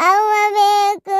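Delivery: put-on slow, high, whiny child's plea; whining for mom. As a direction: drag it out like a tearful toddler. Child crying for its mother. (ಅಮ್ಮನಿಗಾಗಿ ಅಳುವ ಮಗುವಿನ ಧ್ವನಿ)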